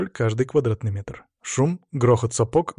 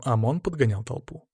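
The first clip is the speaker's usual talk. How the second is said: plain reading voice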